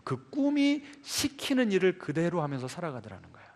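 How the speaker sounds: ordinary talk, nothing marked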